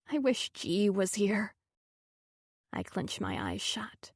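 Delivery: ordinary delivery, nothing marked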